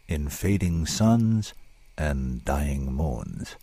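drawn-out, slow announcement of the title; slow, deliberate title; slow title reading. Shown as a demonstration. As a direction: let the title linger.

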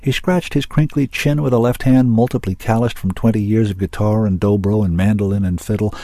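ordinary delivery, nothing marked

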